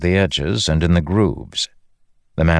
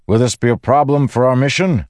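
high, raised voice; shouted question; 9 semitones higher, 4 dB louder than usual. A narrator's reading. Windrunner checking on his companion before the mission, shouting. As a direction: call the question across the wind. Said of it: "he shouted".

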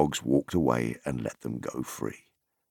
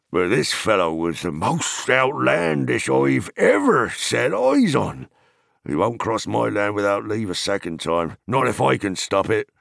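raised farmer voice; indignant hobbit farmer; 5 semitones higher, 3 dB louder than usual. Acted. Farmer telling Pippin of an outlandish stranger, indignant farmer voice. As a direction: complain about a trespasser, pitched up.

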